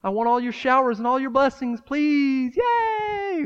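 put-on high, whiny, spoiled-kid voice; hammed-up spoiled child begging Father for treats; spoiled child praying. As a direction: wheedle God like a greedy little kid. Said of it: "These spoiled, spoiled, wicked generation".

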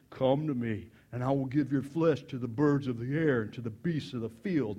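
low-pitched, mildly menacing giant voice; intimidating giant. Retold or imitated. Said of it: "that's pretty intimidating".

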